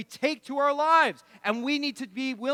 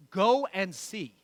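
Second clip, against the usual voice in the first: slow, knowing Jesus; 3 semitones lower, slower than usual. Retold or imitated. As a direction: let it land slowly, like a gentle dare. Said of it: "I think he says it like this too".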